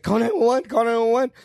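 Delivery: imitating her frantic plea for help; crying roommate begging him to call the police, panicked. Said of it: "She's like".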